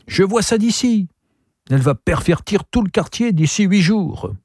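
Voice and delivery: high-pitched outraged tirade; worked-up character complaint; indignant rant. A narrator's reading. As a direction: rail in scandalized protest, voice climbing.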